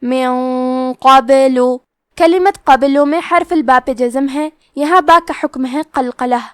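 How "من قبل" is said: من قبل with the noon hidden in a heavy nasal hum; من قبل, noon hummed thick before ق; recited من قبل, heavy nasal noon; heavy ghunna. Shown as a demonstration. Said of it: "غنہ کو بھی بھاری آواز میں ادا کریں گے".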